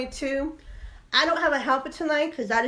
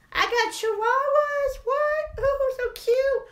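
much higher, slow cooing; cutesy voice; 11 semitones higher, slower than usual. Real, not acted. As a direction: coo over the little dogs. Cutesy voice about dogs.

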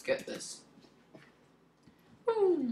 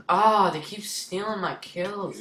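loud, slow, whiny protest; exasperated complaint; 6 semitones higher, 6 dB louder, slower than usual. Real, not acted. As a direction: drag out the complaint. Complaining about kill thieves.

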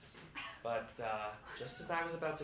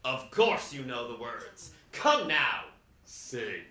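firm jolly Santa; 8 dB louder, slower than usual. Retold or imitated. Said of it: "Santa Santa said".

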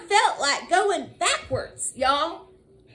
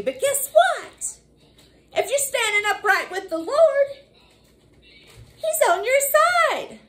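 higher, slow, building; preaching climax; 7 semitones higher than usual. Acted. Preacher to church, high rising climax. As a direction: lift them up word by word.

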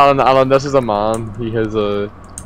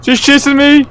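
being chased; 14 semitones higher, 6 dB louder than usual. Real, not acted.

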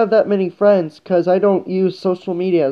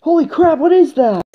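higher-pitched startled exclamation; 8 semitones higher than usual. Acted. Kid startled by something in the sewer, alarmed surprise.

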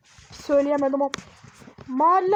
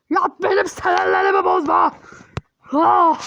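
a touch of a loud irritated order; annoyed; 5 dB louder than usual. Acted. Fed-up parent ordering the kid to bed, irritated. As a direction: snap at him to go to bed, louder. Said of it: "bir sinirlerim geliyor ya".

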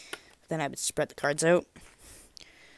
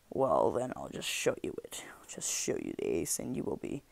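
hushed teasing whisper; 10 semitones lower, 5 dB quieter than usual. Acted. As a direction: whisper it like a secret. Whispered tease before reveal.

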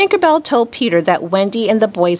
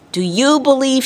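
high calling question; cried out; 7 semitones higher than usual. A narrator's reading. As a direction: call out to every child in the world. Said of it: "he cried".

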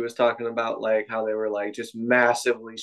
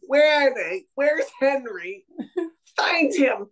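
Kathleen; 11 semitones higher than usual. Retold or imitated. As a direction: mimic a whiny leader giving orders, pitched way up. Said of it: "she sounds so whiny".

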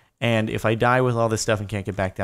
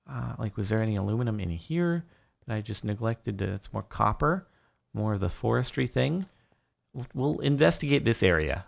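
normal talking voice

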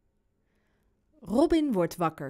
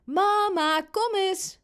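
put-on high, drawn child's call; louder call; 10 semitones higher, 3 dB louder, slower than usual. A narrator's reading. Boy calling mum to his room, loud and insistent. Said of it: "roept Robin iets harder".

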